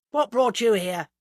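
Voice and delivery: loud, blunt demand. A narrator's reading. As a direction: challenge unwelcome visitors bluntly. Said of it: "asked Mister Parr bluntly".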